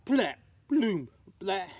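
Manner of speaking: loud comic thuds; comic crash noises; clumsy blap sounds. A narrator's reading. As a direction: bump out each clumsy landing.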